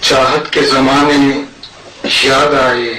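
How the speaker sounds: measured ghazal recitation with long pauses; poetry recital. Acted. Poet presents his verses to a mushaira audience, poetry recital. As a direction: recite in separate phrases, lifting openings and letting ends fall.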